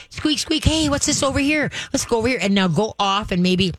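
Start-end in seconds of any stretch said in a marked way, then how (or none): 0.1-0.6 high imitated squeaky-toy squeaks; mock squeaks. Shown as a demonstration. Mimicking the toy for the dog, high squeaks. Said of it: "you're gonna squeak the toy". 0.6-2.4 higher, bright excited call to a dog; dog cheerleader. Shown as a demonstration. Trainer coaxing a reactive dog, high and excited. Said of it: "what you're gonna do".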